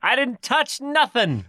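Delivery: high, slow whine of innocence; whiny innocent denial. Acted. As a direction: play the wrongly accused kid.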